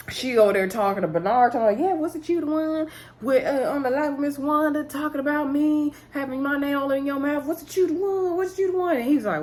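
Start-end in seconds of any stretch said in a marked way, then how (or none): 1.8-8.9 high-pitched accusing imitation; mock confrontation. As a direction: act out calling someone out, pitch climbing on the repeats. Retold confrontation over gossip.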